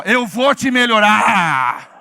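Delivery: drawn-out loud call; slow proclaiming shout. Shown as a demonstration. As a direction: shout it slowly and loudly. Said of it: "fala bem alto assim".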